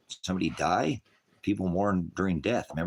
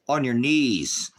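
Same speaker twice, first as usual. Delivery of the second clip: commanding order; 8 semitones higher, 6 dB louder, much slower than usual. Acted.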